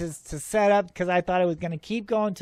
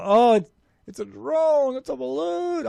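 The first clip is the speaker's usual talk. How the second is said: mocking naysayers; 6 semitones higher than usual. UFO filmer mimics people who say it's a drone, mocking. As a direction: parrot the doubters in a raised, silly voice. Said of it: "a lot of people are saying".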